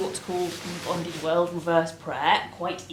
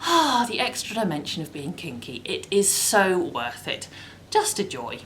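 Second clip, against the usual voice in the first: ordinary talk, nothing marked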